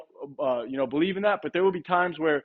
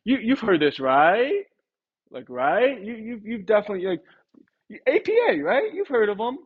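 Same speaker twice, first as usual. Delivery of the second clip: higher, questioning, come-on-you-know tone; reasonable man tonality; 4 semitones higher than usual. Shown as a demonstration. Rep reassures a homeowner they know the company, reasonable and rising. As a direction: coax agreement as if obvious. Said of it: "reasonable man tonality".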